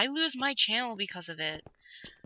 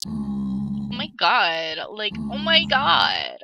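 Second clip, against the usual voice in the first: slow, louder mock "oh my god"; drawn-out oh my god; 4 semitones lower, 7 dB louder, much slower than usual. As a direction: stretch it out for a joke. Hamming up the catchphrase.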